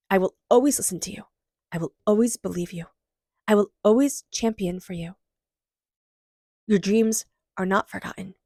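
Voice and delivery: soothing guided meditation read. Acted. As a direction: gently lead listeners to hug their inner child. Guided inner child meditation.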